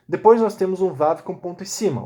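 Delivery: normal talking voice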